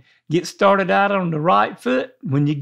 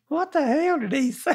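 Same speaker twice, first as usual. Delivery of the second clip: confused newcomer; 8 semitones higher, 5 dB quieter than usual. Retold or imitated. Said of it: "You say".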